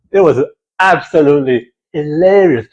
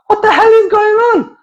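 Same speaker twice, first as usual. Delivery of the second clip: high, bewildered outburst; high baffled yelp; baffled outcry. Retold or imitated. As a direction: blurt it out totally confused. Son baffled by name change.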